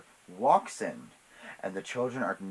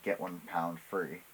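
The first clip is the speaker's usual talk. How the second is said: normal talking voice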